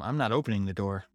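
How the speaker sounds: usual speaking voice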